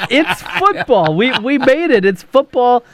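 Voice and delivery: high, thrilled announcement; excited. Real, not acted. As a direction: jubilant. Football season finally here.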